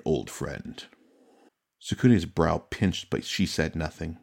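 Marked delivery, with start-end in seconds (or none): 0.1-0.8 put-on softer, lower, fond old friend; fond greeting. Yokuni fondly addresses the Crane champion, low and soft. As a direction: murmur old friend like a trusted companion. Yokuni answers with warm familiarity.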